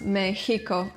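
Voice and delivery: Mexico as "meh-HEE-ko"; Spanish-style Mexico. Shown as a demonstration. Teacher showing what it is not, Mexico as "Mehico". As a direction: say Mexico with a breathy h for the x. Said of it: "not Mexico or Mexico".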